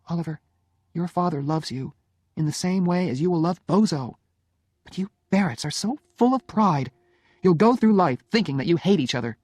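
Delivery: wife pleading, then scolding; reproachful Jenny. Acted. Jenny reproaches proud husband.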